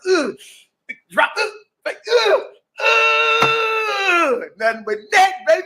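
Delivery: high excited yelling; celebrating shout. Retold or imitated. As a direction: whoop over a swish. Celebrating the three-pointer.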